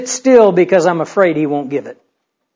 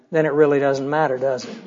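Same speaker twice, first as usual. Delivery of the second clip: low, soft, quick punchline from a cat; Cheshire Cat; 5 semitones lower, 8 dB quieter, faster than usual. Acted. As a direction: drop low and quiet, lightly in character. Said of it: "To which the cat replied".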